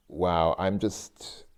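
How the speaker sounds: ordinary delivery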